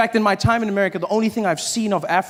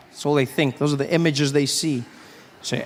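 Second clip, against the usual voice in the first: usual speaking voice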